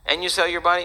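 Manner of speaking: ordinary talk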